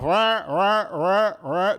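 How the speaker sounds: loud mock alarm buzzing, rising; alarm clock imitation. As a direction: blare it like an alarm clock going off.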